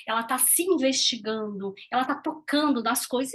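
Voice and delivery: ordinary talk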